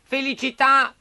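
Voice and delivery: high, loud puppet call; bright blessing. Acted. Pinocchio blessing the sneezing puppeteer, eager high call. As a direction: pipe it out eagerly.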